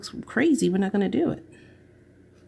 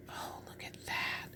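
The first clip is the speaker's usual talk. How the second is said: breathy whisper, very soft; hushed awe; 17 dB quieter than usual. Real, not acted. Crafter admiring the new piece, hushed awe. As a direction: whisper it in wonder. Awed at how it looks.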